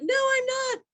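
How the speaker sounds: high, loud mock protest; squeaky defensive "No, I'm not"; indignant denial. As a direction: deny it, hammed-up defensive. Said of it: "And I was like".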